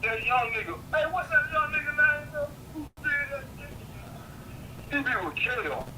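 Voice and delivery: ordinary talk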